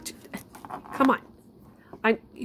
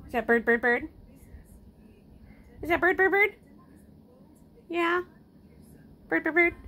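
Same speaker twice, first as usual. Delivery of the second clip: much higher sing-song; 9 semitones higher than usual. Real, not acted. Owner cooing to the pet about the bird, high sing-song. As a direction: coo bird bird bird.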